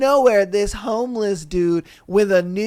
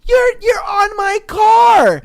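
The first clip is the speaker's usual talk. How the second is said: exasperated yell; 10 semitones higher, 4 dB louder, slower than usual. Retold or imitated. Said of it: "And I'm like".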